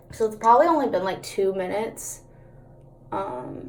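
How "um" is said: ordinary talk, nothing marked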